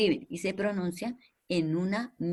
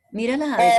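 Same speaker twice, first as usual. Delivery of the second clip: louder, higher insistent urging; firm prompt; 5 semitones higher, 8 dB louder, much faster than usual. Real, not acted. Teacher urging a pupil to look closely, firm and louder.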